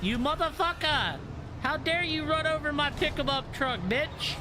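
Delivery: high furious yell at the game; angry yelling. Real, not acted. Raging at game enemy.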